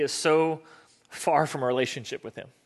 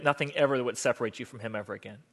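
ordinary delivery

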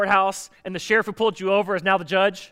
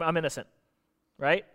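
usual speaking voice

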